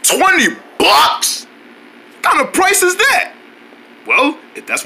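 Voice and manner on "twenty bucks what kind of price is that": high, slow indignant disbelief; outraged surprise. Acted. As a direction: balk at the price. Outraged at price.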